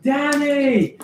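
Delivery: high, drawn-out call across a hall; yelling across. Acted. Delighted host hails Danny across the dining hall, yelling. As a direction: call out the name, high and stretched. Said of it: "he, like, yells across".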